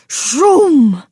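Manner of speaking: loud "shroom" sound effect; crashing whoosh. A narrator's reading. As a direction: voice a tree crashing down. Narrator voices tree crashing.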